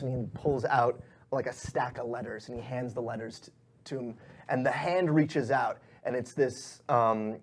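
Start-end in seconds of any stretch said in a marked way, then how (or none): none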